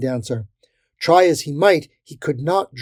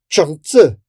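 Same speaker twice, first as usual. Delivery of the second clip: loud, raised battle call; 4 semitones higher, 9 dB louder than usual. A narrator's reading. Crusader invoking a word in battle, calling out. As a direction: call it out like a spell. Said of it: "one of the men called out".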